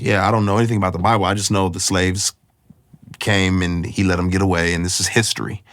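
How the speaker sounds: rattled off fast, a light imitation of the guide; quick dismissive archaeologist. Tour guide brushing off the Bible, fast and offhand. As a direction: toss it off quickly like it doesn't matter.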